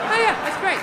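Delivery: light, uneasy placating tone; mock polite humouring. Friend edging away from conspiracy guy, politely humouring him.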